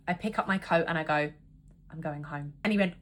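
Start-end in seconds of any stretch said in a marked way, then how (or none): 1.9-2.5 quiet. Retold or imitated. Walking out quietly.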